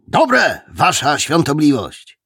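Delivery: high, loud dutiful reply; prisoners answering together. A narrator's reading. Prisoners answer the officer about their food, chorused reply. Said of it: "odpowiedzieli chórem więźniowie".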